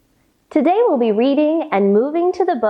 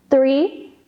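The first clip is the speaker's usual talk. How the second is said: usual speaking voice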